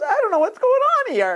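very high, mock-hysterical wail of bewilderment; imitated hysterical crying. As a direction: play the overwhelmed student, voice shooting way up. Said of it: "And she's hysterical crying".

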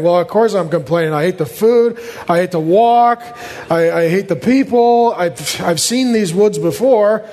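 droning whine, stressing each gripe; hammed-up sulky complaint; mock grumbling. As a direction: moan through a list of grievances. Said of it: "When you think of grumbling, complaining, whining".

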